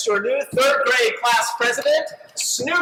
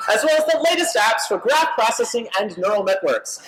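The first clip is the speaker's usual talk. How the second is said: usual speaking voice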